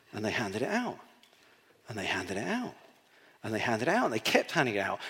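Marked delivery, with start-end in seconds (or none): 0.1-1.0 quieter repeat. Shown as a demonstration. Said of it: "handed it out, repeated". 1.9-2.8 low, hushed repeat; softer still. Shown as a demonstration. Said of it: "handed it out, repeated".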